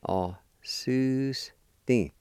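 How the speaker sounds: Au-su-sti drawn out very slowly, each syllable separate. Shown as a demonstration. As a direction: spell out Au-su-sti slowly for learners. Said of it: "is pronounced like this".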